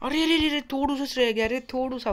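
high, loud cries of dismay; excited yelling. Real, not acted. Gamer shouting as his move falls just short. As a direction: yell arre as it just slips away. Near miss in the game.

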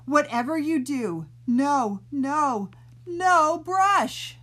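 loud, rising to a high shout; shouting warning. Acted. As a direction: yell to stop the brushing. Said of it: "the three shouted".

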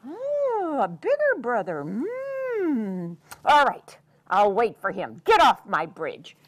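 loud musing troll; troll waits for the bigger one. Acted. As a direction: hmm it out like the troll. Troll relents.

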